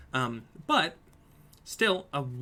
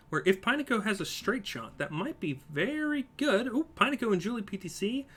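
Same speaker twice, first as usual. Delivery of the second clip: live play-by-play; 4 semitones higher than usual. Real, not acted. Streamer calling a marble race for viewers, lively play-by-play. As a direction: race commentary, rising with the action.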